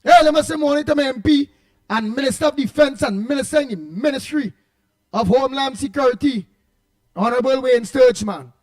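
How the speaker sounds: big booming on-air greeting, high and loud; loud shout-out. Real, not acted. Radio host hails the Minister of Homeland Security, loud shout-out. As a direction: hail him like a VIP on radio.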